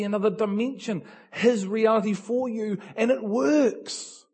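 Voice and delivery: loud, high, fervent preaching; preaching at full force. Acted. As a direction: preach it out with rising fervour.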